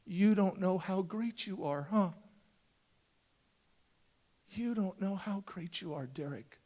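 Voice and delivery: hushed, tender realization; very light voice. Retold or imitated. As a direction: say it lightly, letting the name drop. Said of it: "she said very lightly".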